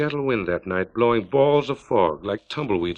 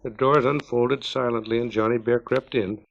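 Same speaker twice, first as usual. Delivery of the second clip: ordinary delivery, nothing marked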